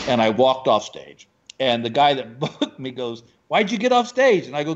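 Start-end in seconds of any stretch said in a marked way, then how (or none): 3.5-4.4 high-pitched retold demand; confronting. Booker to comic who walked off, high-pitched confronting. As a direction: challenge him, pitch jumping up.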